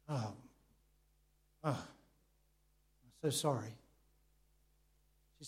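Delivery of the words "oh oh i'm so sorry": soft, slow, sorrowful reply; stunned sympathy. Retold or imitated. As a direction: let it fall softly with long pauses, as if taking in bad news. Retold reaction to sister's tragic news.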